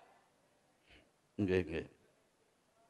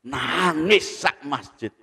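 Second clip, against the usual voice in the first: normal talking voice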